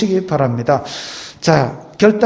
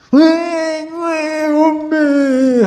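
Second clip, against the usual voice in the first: put-on high whiny baby wail, stretched out; crying baby; 10 semitones higher, 3 dB louder, much slower than usual. Preacher imitating a baby crying for mom. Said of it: "하고 웁니다".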